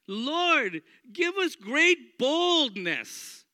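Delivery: loud, high, slow fervent prayer; bold prayer. Retold or imitated. The church praying boldly, loud fervent pleading. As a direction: pray with full conviction. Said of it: "That's not their prayer".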